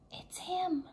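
whispered. A narrator's reading. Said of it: "Annie whispered".

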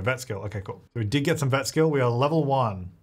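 ordinary delivery

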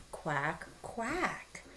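"Quack, quack" as a duck. Shown as a demonstration. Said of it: "A duck says".

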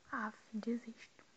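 slow defeated whisper; muttered surrender; whispered giving up. Real, not acted. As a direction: sigh the words out voicelessly. Giving up on the search.

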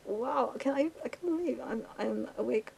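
giddy amazed outburst. Real, not acted. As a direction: squeal out the disbelief up high. Amazed at staying awake.

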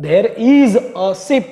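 there is a ship, drawn out word by word; slow dictation. Shown as a demonstration. Teacher repeating the example sentence slowly for students. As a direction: spell it out slowly for the class. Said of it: "example sentence, repeated slowly".

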